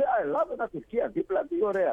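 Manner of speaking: high, airy mock enthusiasm; imitated naive delight. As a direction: play the clueless friend, pitched well up. Imitating friend's naive delight about geography. (μιμούμενος αφελή ενθουσιασμό, ψηλά)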